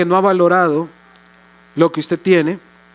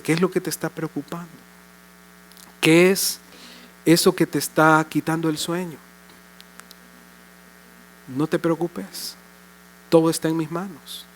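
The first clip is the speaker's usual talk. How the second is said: tender; 5 dB quieter than usual. Acted. God consoling the listener, eyes closed.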